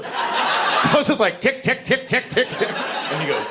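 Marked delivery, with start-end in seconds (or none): 1.4-2.7 high, light ticking noise; imitated ruler knocking. Dad knocks on son's door with a ruler, imitated ticking. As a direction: tick out quick raps on a door. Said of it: "I actually knocked with the ruler".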